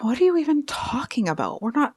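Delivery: high-pitched, indignant mock disbelief; attitude. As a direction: play the accused rival, full of attitude. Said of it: "I'm catching an attitude".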